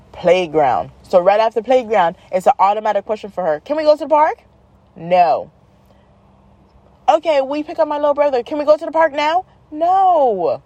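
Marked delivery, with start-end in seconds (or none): 3.6-4.4 put-on higher, rising child's question; child pestering mom. Daughter asks mom for the park, pestering. 7.1-9.4 put-on quick, higher child voice; child pestering mom. Daughter nags mom after pickup, eager pestering. Child asks again in story. 9.7-10.6 exasperated mom. Retold or imitated. Exasperated mom's answer, acted.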